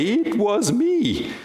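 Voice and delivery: high, drawn-out mock confession; shamefaced owning up. Woman having to come out in public shame, high sheepish confession. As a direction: stretch it out in a small, high voice. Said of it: "imagine that public shame now".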